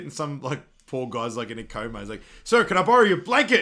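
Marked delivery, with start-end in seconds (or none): 2.4-3.6 high, loud mock shout; loud demand. Asking the coma patient for his blanket, loud high shout. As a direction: yell it at a man in a coma.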